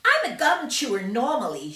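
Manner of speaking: put-on loud, high, bratty girl's voice; shouting Violet. Violet shouting at the crowd of reporters, loud and high. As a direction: shout it out like a spoiled gum-chewing girl. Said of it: "she shouted".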